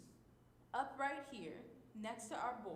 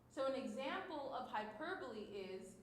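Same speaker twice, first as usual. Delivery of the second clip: ordinary delivery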